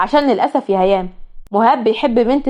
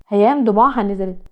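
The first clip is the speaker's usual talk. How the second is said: ordinary delivery, nothing marked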